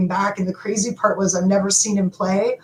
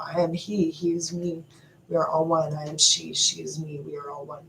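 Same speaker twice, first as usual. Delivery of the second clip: fading into a hushed chant; soft mantra; 3 semitones lower, 8 dB quieter than usual. Real, not acted. Spiritual vlogger to viewers, chanting softly into a whisper. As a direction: breathe it out like a mantra, softer each line.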